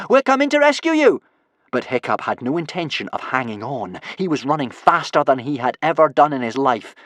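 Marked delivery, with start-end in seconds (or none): 0.1-1.2 very high, loud call across the ice; shout. A narrator's reading. Kamikaze at the wheel shouts to Hiccup, loud and high. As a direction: yell it to him from the wheel. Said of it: "shouted Kamikaze".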